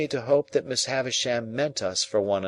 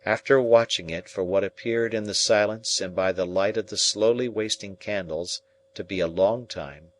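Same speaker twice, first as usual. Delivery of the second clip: usual speaking voice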